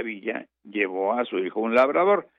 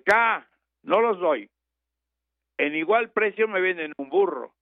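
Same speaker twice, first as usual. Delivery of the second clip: stubborn refusal; 8 semitones higher than usual. Retold or imitated. Farmer refuses again.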